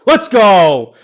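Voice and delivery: loud, high cheer of triumph; excited shout. Real, not acted. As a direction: yell it out as the ball drops. Celebrating a golf shot.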